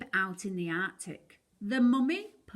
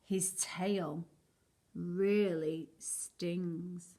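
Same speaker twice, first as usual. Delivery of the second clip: slow, hushed warning; ominous warning; 2 semitones lower, much slower than usual. A narrator's reading. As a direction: draw out really stings. Warning about stingray.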